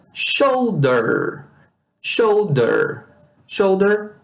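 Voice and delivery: "shoulder" with a held, curled-tongue R at the end; English final R. Shown as a demonstration. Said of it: "chama atenção para esse R final".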